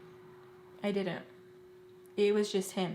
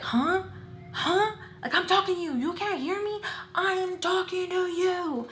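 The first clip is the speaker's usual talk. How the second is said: put-on high, loud, exasperated boyfriend; mock angry shouting, pitched way up; angry demanding yell; 10 semitones higher, 4 dB louder than usual. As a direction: imitate him yelling for attention, stretching the last demand. Boyfriend demands an answer in argument.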